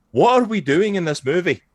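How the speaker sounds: high, loud mock disbelief; exasperated. Viewer questioning the muddled film, loud mock exasperation.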